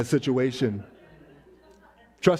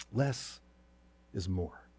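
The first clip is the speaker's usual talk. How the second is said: soft, slow, hushed less is more; quiet refrain; 7 semitones lower, 9 dB quieter, slower than usual. Real, not acted. Preacher softly repeating his refrain to the congregation. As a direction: let the words land softly. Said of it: "sermon refrain".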